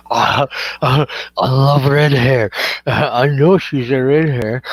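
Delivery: low, loud lustful mock voice; slow lecherous drooling with silly laughs; horny drooler impression. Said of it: "like, acting like a horny little".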